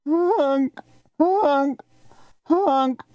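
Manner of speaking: high imitated goose honks, fading away; distant goose honks. A narrator's reading. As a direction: call out like geese far overhead. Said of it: "that high distant sound".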